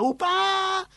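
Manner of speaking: put-on high, drawn-out toddler whine; imitated wailing child. Little boy arriving in tears, imitated high wail.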